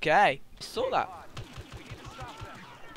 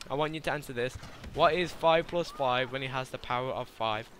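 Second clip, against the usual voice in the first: ordinary delivery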